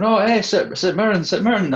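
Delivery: high-pitched mock Irish accent, calling out; strong Irish accent. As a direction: call out in a put-on Irish lilt, pitched well up. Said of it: "this strong Irish accent going".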